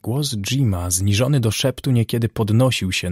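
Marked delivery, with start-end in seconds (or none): none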